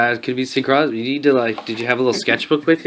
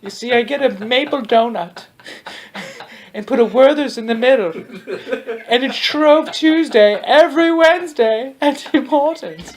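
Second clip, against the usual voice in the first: put-on high-pitched, creepy old lady act; overly kind lady; 9 semitones higher than usual. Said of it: "The overly kind lady".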